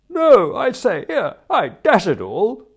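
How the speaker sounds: very high, loud wail; outraged protest. Acted. Convicted friend protesting his sentence, high loud indignation. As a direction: protest in a drawn-out high yelp. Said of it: "protested poor old Sippy".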